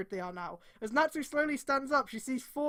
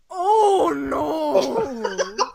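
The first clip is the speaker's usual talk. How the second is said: high, loud laughing cry of horror; horrified laughing shout; 4 semitones higher, 11 dB louder than usual. Real, not acted. Streamer seeing awful fan art, horrified shout. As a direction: recoil from the image, laughing.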